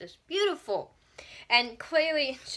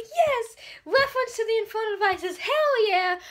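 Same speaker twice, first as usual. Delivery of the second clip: much higher, loud fangirl whoop; excited cheer; 10 semitones higher, 5 dB louder than usual. Retold or imitated. Fan cheering the Infernal Devices reference, high and loud. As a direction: celebrate spotting the book reference. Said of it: "and I was like".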